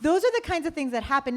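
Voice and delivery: normal talking voice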